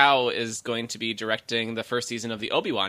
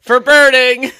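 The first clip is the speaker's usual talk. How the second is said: high, loud joking battle cry; loud shout; 11 semitones higher, 12 dB louder, slower than usual. Pretend. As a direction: belt it out like a charge. Shouted punchline to rally cry.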